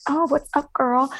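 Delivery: high, casual retold hello; breezy greeting. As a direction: greet her like an old girlfriend.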